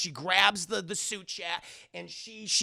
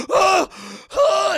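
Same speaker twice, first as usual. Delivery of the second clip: two loud high gasping yells; mock struggle cries; 12 semitones higher, 12 dB louder than usual. Man throwing the bed, loud high mock cries. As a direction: yelp as in a violent struggle.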